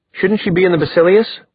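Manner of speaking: high, insistent question; demanding. A narrator's reading. Simon worried for Clary, demanding. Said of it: "Simon demanded".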